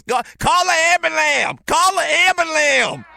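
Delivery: high, loud twangy shout of "ambulam"; impression of old coach shouting. Old coach yells for help at practice, high frantic shout. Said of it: "he just talked like this".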